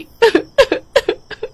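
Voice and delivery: hammed-up high-pitched crying; high wailing sob. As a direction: sob shrilly after the shock. Bald girl wails over lost hair. (ज़ोर का रोना)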